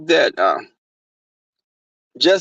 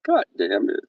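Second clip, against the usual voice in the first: ordinary delivery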